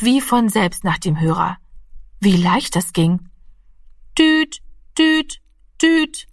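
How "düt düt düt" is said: high, beeping imitation of a dial tone; phone dial tone. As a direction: beep it out like an old telephone. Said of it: "machte es".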